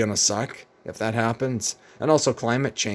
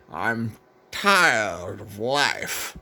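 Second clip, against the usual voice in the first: put-on slow, drawn-out weary old man; William F. Buckley; much slower than usual. Old Buckley telling an interviewer he is tired of life, slow and weary. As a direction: let it drag out as a worn-out old conservative. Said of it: "And then he's like".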